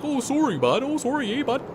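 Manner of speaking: high-pitched, hammed-up apologetic Canadian; mock Canadian accent, sing out the apology way up high. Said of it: "None of that Canadian shit".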